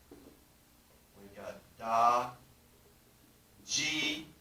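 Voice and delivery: da drawn out very slowly, then ji; slow d syllables. Shown as a demonstration. Teacher sounding out da and ji. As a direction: stretch da out slowly. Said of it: "we just take two".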